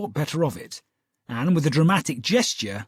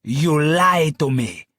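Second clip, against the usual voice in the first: slow, louder furious accusation; 5 dB louder, slower than usual. Acted. Client to Holmes, furious at being lied to. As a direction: hurl it at him.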